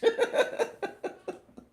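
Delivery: loud, high burst of laughter; big belly laugh. Real, not acted. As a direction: explode into laughter. Retells husband correcting her Spanish.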